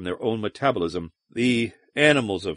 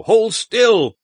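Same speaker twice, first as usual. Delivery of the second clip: much higher, loud strained bellow; roaring yell; 10 semitones higher, 6 dB louder than usual. A narrator's reading. Wocher bellows at the monster he wrestles, high and loud. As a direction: bellow it mid-struggle, straining. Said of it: "he roared".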